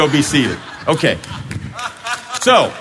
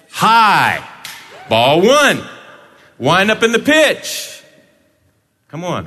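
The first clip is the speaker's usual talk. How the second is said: lively, higher play-by-play calls of each pitch; mock play-by-play; 5 semitones higher, slower than usual. Pastor narrating a pretend at-bat, mock play-by-play. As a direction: call the game like a ballpark announcer.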